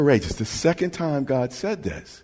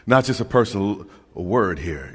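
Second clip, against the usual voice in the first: ordinary talk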